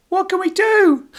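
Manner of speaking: high, loud desperate call; shout. Acted. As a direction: call for help over the fight. Jay calling out from desk.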